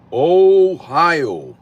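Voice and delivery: high, loud, stretched-out Ohio; drawn-out call-out. Real, not acted. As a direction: call it out big, swooping up and falling. Playful repeated call-out of Ohio.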